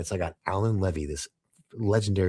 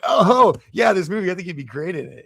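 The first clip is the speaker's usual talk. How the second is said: higher, louder, mock teenage eagerness; dumbass high school kid; 10 semitones higher, 6 dB louder than usual. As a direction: blurt it out like a nervous teenager with a crush. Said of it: "like a dumbass high school kid".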